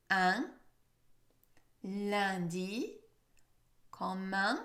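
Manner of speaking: "Un lundi commun" prominent nasal vowel; French nasal demo. Shown as a demonstration. Teacher repeating the words for learners. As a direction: model "commun" slowly and clearly. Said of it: "Listen again".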